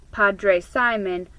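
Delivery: plain reading voice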